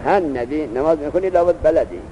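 ordinary delivery

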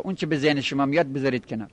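usual speaking voice

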